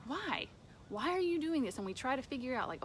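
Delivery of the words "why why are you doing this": higher pleading cry of why. Retold or imitated. Believers crying out to God, higher pleading why. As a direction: mimic a worn-out believer begging God for answers. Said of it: "We're going".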